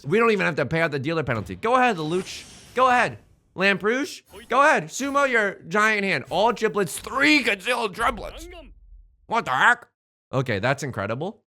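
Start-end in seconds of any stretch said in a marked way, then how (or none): 1.6-9.9 high-pitched, worked-up taunting; incredulous high outburst; exasperated goading. Real, not acted. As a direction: egg them on in rising disbelief. Goading opponent winning a giant hand.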